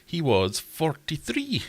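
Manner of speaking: slow, high answer reveal; dramatic reveal. Acted. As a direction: draw out the answer like a host.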